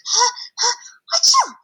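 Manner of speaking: sharp high gasps building into a big achoo; hammed-up sneeze. Reading to kids, the town mouse sneezes at the flowers, a hammed-up high achoo. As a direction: wind up gasping, then let the sneeze burst out high. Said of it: "the flowers made him sneeze".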